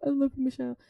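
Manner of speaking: high, fast mock gushing over flowers; mock delighted boyfriend thanking for flowers; gushing thanks. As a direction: squeal it like an over-thrilled boyfriend. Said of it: "be like".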